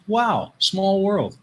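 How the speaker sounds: usual speaking voice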